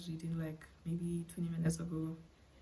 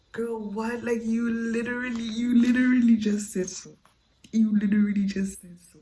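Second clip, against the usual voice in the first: incredulous; 3 semitones higher, 10 dB louder than usual. Real, not acted. Mocking the book heroine.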